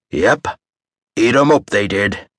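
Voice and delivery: lightly voiced pirate with a hint of Irish; a touch of Irish pirate storyteller; dramatic piratey tone. A narrator's reading. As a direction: ham up the old sea dog a touch. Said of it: "in a dramatic and piratey tone that had a fair amount of Ireland".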